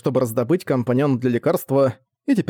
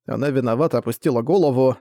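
usual speaking voice

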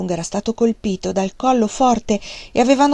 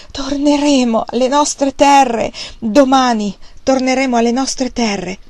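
louder, slow joyful vow; elated repeating; 5 semitones higher, 5 dB louder than usual. A narrator's reading. Wang Lung clutching the gold, repeating with joy. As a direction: savor the promise of going home. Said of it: "continuando a ripetersi".

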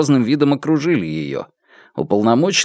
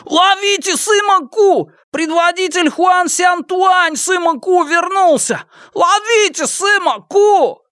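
very high, slow, drawn-out alarm cries; barking shouts; slower than usual. A narrator's reading. Fleeing man raising the village against the fugitive, high barking shouts. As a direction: raise the alarm, stretching out each high shout. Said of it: "послышались его лающие крики".

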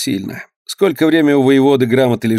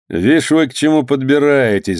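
ordinary delivery, nothing marked

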